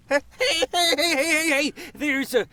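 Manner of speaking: high, excited cartoon dog. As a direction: yap it out like an alarmed pup. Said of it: "with the voice?".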